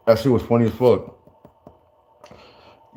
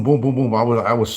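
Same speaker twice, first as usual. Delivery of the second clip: ordinary talk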